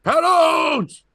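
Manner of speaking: high, loud yelled name; drunk shout. Retold or imitated. Drunk guy shouting the comic's name as he walks up, loud. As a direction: bellow it like a rowdy drunk.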